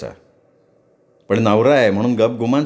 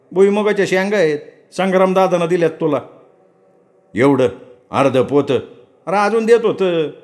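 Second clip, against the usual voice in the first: higher, complaining farmhand; servant Saravanya; 5 semitones higher than usual. Acted. Servant Saravanya complaining to Raghu about the load, lightly voiced. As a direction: grumble about the heavy sack.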